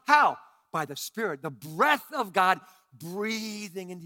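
loud, slow, shouted sermon climax; booming pulpit preaching; full-force preaching. Acted. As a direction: preach it big and drawn out. Preaching builds again.

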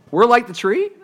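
high-pitched questioning rise; mock disbelief. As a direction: query the answer as if astonished. Mock disbelief at kids' answer.

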